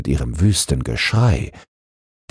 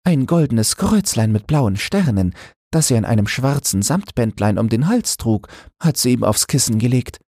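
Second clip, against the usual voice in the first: higher woman's voice, telling gently; a mother recounting a keepsake, higher pitched; Annemarie telling; 8 semitones higher than usual. Acted. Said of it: "sagte Annemarie". (Annemarie, höhere Frauenstimme, ruhig erzählend)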